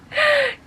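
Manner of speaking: dramatic gasp. Acted. Reader reaching the climax of the book, dramatic gasp. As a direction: gasp at the plot twist.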